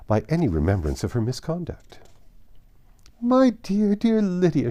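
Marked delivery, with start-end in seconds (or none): 3.2-4.7 high, loud gushing mother; overjoyed mother gushing over her daughter's wedding; excited cry. A narrator's reading. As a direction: squeal her daughter's name with delight. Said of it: "she cried".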